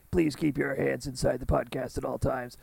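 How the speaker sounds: theme park ride spiel. Pretend. Mock ride safety announcement.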